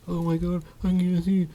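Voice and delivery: very high, put-on whimper; Wesker imitation. Vlogger acts out Wesker freaking out, very high mock whimper. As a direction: squeak it out in mock helplessness. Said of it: "he's literally like".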